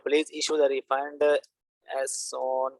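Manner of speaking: slow, drawn-out dictation while typing; typing aloud slowly. Shown as a demonstration. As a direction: spell it out slowly as you type. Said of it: "अब मैं यहाँ पे लिख के". (Type करते हुए धीरे-धीरे बोलना)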